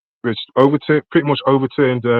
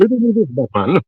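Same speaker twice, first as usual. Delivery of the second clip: underwater; 5 semitones higher than usual. Real, not acted. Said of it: "it sounds like you're underwater".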